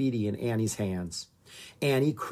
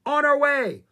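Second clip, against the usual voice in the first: high, loud, urgent acknowledgement; yelling; 14 semitones higher, 7 dB louder than usual. A narrator's reading. Jomo answers the emergency radio call, yelling. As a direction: yell it into the radio, ready to race off. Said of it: "Jomo yelled into the radio receiver".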